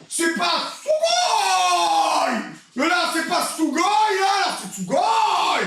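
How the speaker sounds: high, drawn-out yell of joy; ecstatic shout. Real, not acted. Streamer celebrating his new studio, shouting with joy. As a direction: belt it out like a victory cry. Touring studio yelling Sugoi.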